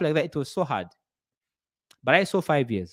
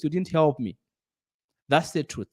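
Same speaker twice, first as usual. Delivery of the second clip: ordinary delivery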